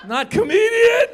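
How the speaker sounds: high, loud exclamation of disapproval; hammed-up high-pitched protest; mock parental outcry. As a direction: yell it as an appalled parent. Voices parents' disapproval of comedy career.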